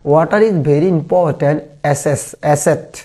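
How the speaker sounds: very slow, drawn-out reading; slow dictation. Shown as a demonstration. Teacher dictating the answer sentence to the class, very slow. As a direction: give each word time for copying.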